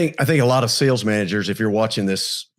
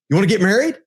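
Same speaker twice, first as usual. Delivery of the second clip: higher-pitched, overeager suitor; eager mock proposal; 8 semitones higher, faster than usual. Man jokingly proposing at the door after a first date, eagerly. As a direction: pop the question eagerly, higher, in jest.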